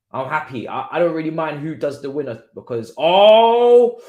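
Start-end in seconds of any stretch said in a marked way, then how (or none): 3.0-4.0 long, high, very loud "ohhh" yell; excited "oh!". Real, not acted. Fan seeing a near chance, loud excited "oh". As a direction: erupt in a drawn-out shout of near-miss shock.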